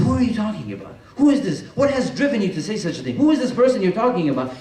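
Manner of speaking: a ruler outraged at a woman's words; indignant demand. Acted. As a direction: snap out the questions like an offended king. Muawiyah angrily questions the woman.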